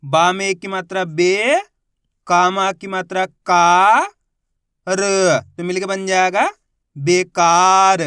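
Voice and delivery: हर अक्षर अलग, फिर "बेकार" धीमा और ज़ोर से जोड़ो; "बे... का... र" तोड़कर, फिर पूरा "बेकार" खींचकर; अक्षर जोड़कर पढ़ना. Shown as a demonstration. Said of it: "देखो किस तरीके से है". (बच्चों को मात्रा समझाते हुए धीमा ज़ोरदार उच्चारण)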